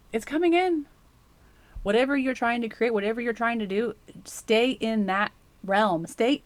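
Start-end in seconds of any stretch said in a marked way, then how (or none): none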